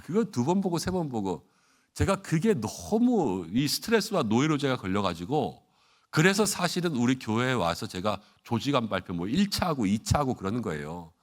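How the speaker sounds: ordinary talk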